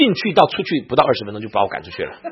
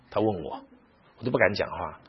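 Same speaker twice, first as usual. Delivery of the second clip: ordinary talk, nothing marked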